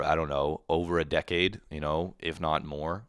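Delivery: ordinary delivery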